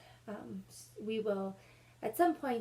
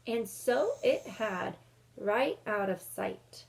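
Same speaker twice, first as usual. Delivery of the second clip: put-on lively read-aloud for kids, a bit louder; story narration; 2 semitones higher, 5 dB louder than usual. A narrator's reading. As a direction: read the chapter to the class with energy. Teacher reads chapter aloud.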